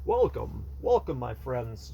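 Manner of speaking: low, slowed vampire greeting; lightly voiced vampire host. Shown as a demonstration. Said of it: "I have to sound like a vampire".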